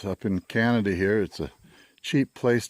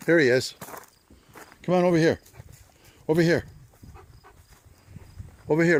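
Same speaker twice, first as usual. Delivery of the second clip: high, loud call across the yard; calling from far away; 7 semitones higher, 3 dB louder, faster than usual. Real, not acted. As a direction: call the dog over from a distance.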